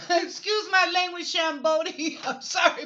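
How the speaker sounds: real laugh while excusing the swearing; laughing apology. Real, not acted. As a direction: giggle through the sorry.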